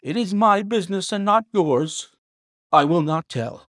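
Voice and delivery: high defiant servant voice; defiant butler. A narrator's reading. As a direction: stand your ground. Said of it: "The man's face became openly defiant".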